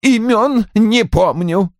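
high, drawn-out dismissal; impatient, high-pitched brush-off. A narrator's reading. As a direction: wave the question away testily. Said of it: "нетерпеливо отмахнулся Рой".

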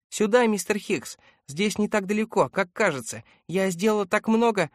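put-on high, animated child's voice; eager girl calling. A narrator's reading. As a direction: call back brightly and proudly as a little girl. Girl calls back to Hicks.

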